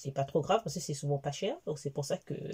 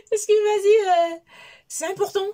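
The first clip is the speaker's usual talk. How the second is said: laughing shout; 14 semitones higher, 11 dB louder than usual. Retold or imitated. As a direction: half-shout through laughter. Cite son insistance, en riant. (crie en riant, voix très aiguë)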